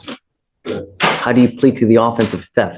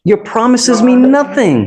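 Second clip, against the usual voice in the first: stern rebuke; 12 semitones higher, 5 dB louder, slower than usual. Real, not acted. Judge rebukes defendant.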